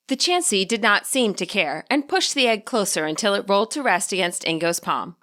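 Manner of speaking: plain reading voice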